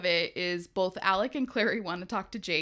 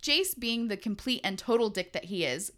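ordinary talk

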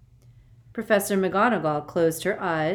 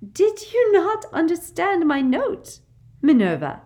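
honeyed; 13 semitones higher, 10 dB louder than usual. A narrator's reading. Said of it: "in honeyed tones".

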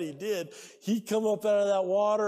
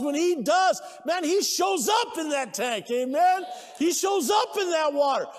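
high, loud exhortation; fired-up pulpit shout; full-force preaching; 7 semitones higher, 5 dB louder than usual. Real, not acted. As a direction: preach it hard, driving each phrase up.

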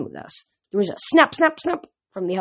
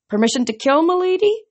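higher, eager request; 10 semitones higher, 4 dB louder than usual. Acted. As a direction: ask eagerly, pitched up. Archer asks goddess.

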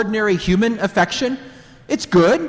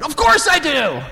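eager exclamation; 11 semitones higher than usual. Retold or imitated. Eager young Peter.

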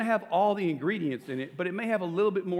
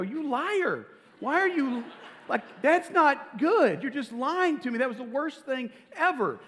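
higher, pitched-up retold outburst, not truly shouted; husband mock-protests his wife's praise, raised and indignant; mock angry protest; 8 semitones higher than usual. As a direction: replay the sulky denial in a raised mock-angry voice. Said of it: "And I go".

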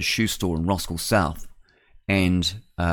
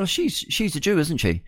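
much higher, mildly surprised remark; retold casual realization; 9 semitones higher than usual. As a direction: toss it out as a high-pitched retold realization.

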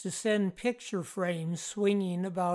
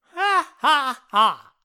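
loud, high staged cackle; loud mock laugh; 8 semitones higher, 13 dB louder, slower than usual. A narrator's reading. Jeff gloats over the Irvings, loud mock laugh. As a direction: deliver a hammed-up triumphant laugh. Jeff's laugh after his boast.

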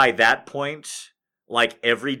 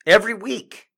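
mock exasperated; 7 semitones higher than usual. Comic rant opener.

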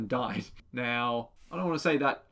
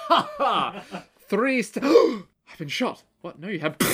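high cackle cut off by a gasp; gloating then shot; 7 semitones higher, 5 dB louder, slower than usual. Retold or imitated. Retelling a cartoon villain getting shot, high hammy gasp. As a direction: laugh smugly, then gasp and slow down.

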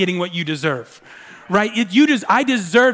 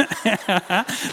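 short chuckle at own impression; amused laugh; 5 dB quieter than usual. Real, not acted. Laughs after imitating his son.

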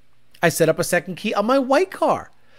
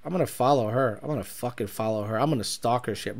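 quiet, low muttered vow; 10 semitones lower, 11 dB quieter than usual. Real, not acted. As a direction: mutter it to yourself. Quietly vowing to stalk her.

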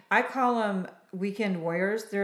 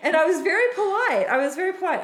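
laughing insistence; 10 semitones higher, 8 dB louder, faster than usual. Real, not acted. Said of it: "insisting politeness".

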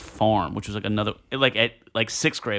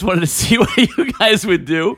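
the teacher's line, broken by a laugh; 8 semitones higher, 4 dB louder, slower than usual. Retold or imitated. Teacher revealing her test to the boys, laughing. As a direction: let a laugh slip in mid-quote. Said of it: "she goes".

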